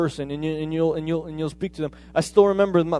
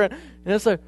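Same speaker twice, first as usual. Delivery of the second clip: ordinary talk, nothing marked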